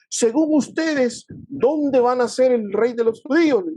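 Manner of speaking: a mildly acted royal question, opening slowly and high; Herod questioning. Herod asks the rabbis about the birth, lightly acted questioning. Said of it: "Y entonces le dijo".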